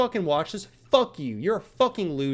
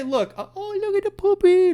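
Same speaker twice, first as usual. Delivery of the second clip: mock baby talk; 5 dB louder than usual. Cooing at the neighbor's dog.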